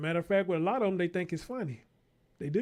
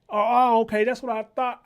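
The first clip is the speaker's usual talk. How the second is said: 1 mock surprise; 3 semitones higher, 8 dB louder than usual. Feigned realization, loud.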